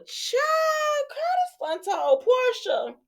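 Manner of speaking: high, drawn-out scandalized delivery; gossipy exclamation. Real, not acted. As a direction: stretch it out high like juicy gossip. Amused gossip reaction to the recap.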